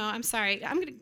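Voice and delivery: usual speaking voice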